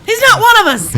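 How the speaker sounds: high loud mock alarm; hammed-up alarm. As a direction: declare it in fake panic.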